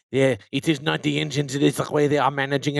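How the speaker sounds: hammed-up bad French accent; fake terrible French accent. Host mimicking Renault engineers to French guest, fake French accent. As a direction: imitate the Renault guys in a badly faked French accent. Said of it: "my fake terrible French accent".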